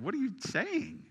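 high, rising incredulous question; retold surprise. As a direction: ask it in disbelief, voice jumping up. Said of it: "I said".

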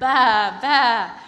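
loud bleating baa; sheep bleating. Acted. Sheep noise.

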